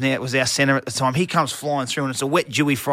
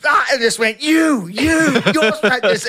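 higher, louder mock coach's rant; coach losing it; 9 semitones higher, 6 dB louder, slower than usual. Coach Madge going off at the players after a loss, shouting. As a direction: blow up at the team. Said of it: "He goes".

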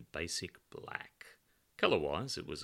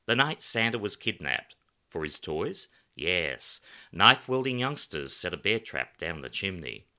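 louder, gleeful macabre teller; Pedro with dark relish, louder; 8 dB louder than usual. A narrator's reading. Pedro relishes telling Alan about his Santa play, loud and gleeful. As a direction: savour the grim details, louder.

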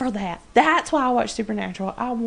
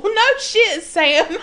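laughing; 8 semitones higher, 4 dB louder than usual. Real, not acted. Laughing retort at Sam.

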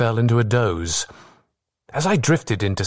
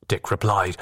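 plain reading voice

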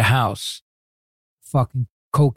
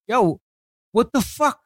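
retold outrage; 8 semitones higher than usual. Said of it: "And I'm like".

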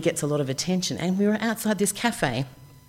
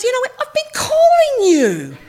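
exasperated agent; 9 dB louder than usual. Retold or imitated.

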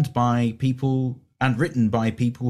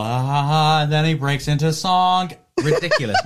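Jeff Goldblum impression; 5 semitones higher, 3 dB louder than usual. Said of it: "Let's do Jeff Goldblum".

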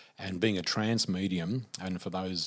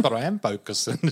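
louder, indignant protest; 4 semitones higher, 9 dB louder than usual. Retold or imitated. Teller protesting to the healer, louder. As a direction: insist you are trying.

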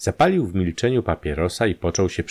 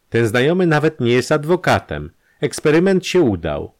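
higher, louder cutting revelation; vindictive, voice raised and insistent; triumphant scorn; 6 semitones higher, 5 dB louder than usual. Acted. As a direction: deliver the reveal with scornful triumph. Scornful revelation in acted quarrel.

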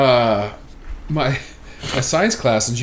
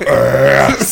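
loud burp shaped into a word; 10 dB louder than usual. Shown as a demonstration. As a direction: belch the word out loudly. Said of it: "I use it to say the word ass loudly".

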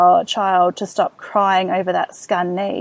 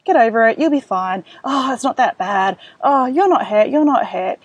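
mock dismissive parent; 3 semitones higher, 4 dB louder than usual. Shown as a demonstration. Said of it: "it's really important not to just dismiss feelings".

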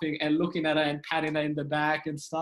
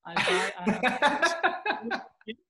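higher-pitched pleading in broken English; mom impression; 6 semitones higher, 3 dB louder, slower than usual. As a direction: imitate Mom insisting, climbing higher and slowing down. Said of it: "And she's like".

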